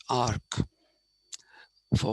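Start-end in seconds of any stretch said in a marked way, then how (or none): 0.1-0.6 "arch" said as ark; k sound in arch. Shown as a demonstration. Teacher giving the correct pronunciation, "arch" as ark. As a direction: say "arch" ending in a hard K. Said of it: "it's pronounced arch".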